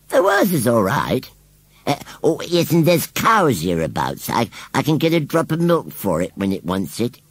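high-pitched rustic rambling; easygoing rustic scarecrow. A narrator's reading. Scarecrow explains plans for the baby, untroubled. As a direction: play a scarecrow airing his plans. Narrator voices Gummidge the scarecrow.

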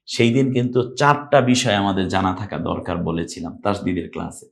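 ordinary talk

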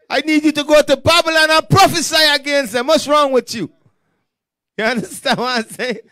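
loud, high-pitched scolding order; exasperated command. Acted. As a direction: thunder at a servant who won't get moving. God scolds idle Ezekiel.

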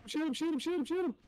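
high, loud repeated urging; urgent callout. Real, not acted. Urging his partner to fire during the fight, urgent. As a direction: urge him to fire fast.

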